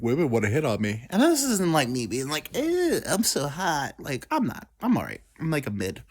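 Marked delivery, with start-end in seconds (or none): 2.5-3.9 slow, high, self-adoring mock voice; hammed-up conceited brag; mock vanity. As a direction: stretch it out high, pretending to be full of yourself. Mock vain self-impression, then denied.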